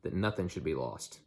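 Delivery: ordinary delivery, nothing marked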